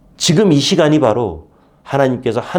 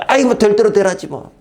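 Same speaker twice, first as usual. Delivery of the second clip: sulky giving up; 9 semitones higher, 3 dB louder than usual. Retold or imitated. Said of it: "이불을 확 뒤집어 쓰고".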